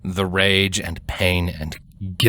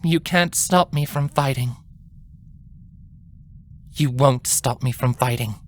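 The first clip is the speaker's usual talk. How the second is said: nervous defiance; 6 semitones higher than usual. A narrator's reading. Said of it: "Her words carried resolved defiance, yet they were still nervous and quiet".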